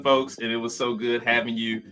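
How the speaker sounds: ordinary delivery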